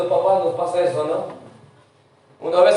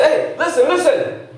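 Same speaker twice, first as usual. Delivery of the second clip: higher-pitched "Hey, listen, listen" call; calling out for attention; 7 semitones higher than usual. Shown as a demonstration. Pastor calling the distracted congregation back to attention. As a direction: snap the distracted crowd back. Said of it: "cuando uno dice".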